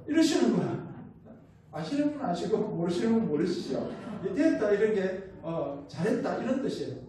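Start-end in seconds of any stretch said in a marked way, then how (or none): 4.3-4.7 loud, higher-pitched Gyeongsang-dialect word. Shown as a demonstration. Preacher explaining God's word to listeners, Gyeongsang dialect demonstration. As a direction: lightly demonstrate punchy Gyeongsang intonation. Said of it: "하나님이 경상도 말로".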